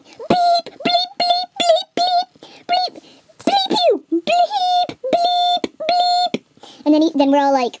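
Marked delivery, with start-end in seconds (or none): 0.0-6.4 very high-pitched censor bleeps; imitated cussing. Everyone cussing over the lost scroll, squealed bleeps. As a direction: squeal a string of bleeps for swear words. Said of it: "everyone's all like".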